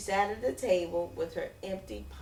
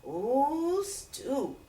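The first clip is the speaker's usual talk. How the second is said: delighted ooh; 5 semitones higher than usual. Acted. Vendor delighted by stew.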